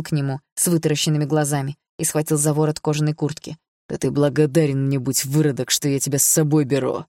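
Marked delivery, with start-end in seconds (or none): none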